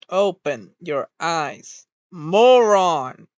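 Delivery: slow build, then moron yelled loud; fed-up shout. Real, not acted. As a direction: spell it out slowly, then explode on moron. Yelling at avatar again.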